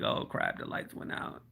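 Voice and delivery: breathy whisper, no voice at all; whispered panic. Retold or imitated. Retelling the storm blackout to viewers, whispered. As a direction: whisper it like the room just went dark. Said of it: "And I'm like".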